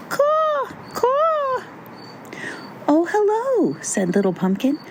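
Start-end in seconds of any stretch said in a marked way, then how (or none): 0.1-1.6 very high, drawn-out bird call; piercingly high crow cry; crow caw. A narrator's reading. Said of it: "who replied, as crows so often do". 2.9-3.8 high-pitched small character voice; high little pumpkin voice; little pumpkin greeting. A narrator's reading.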